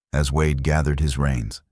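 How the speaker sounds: plain reading voice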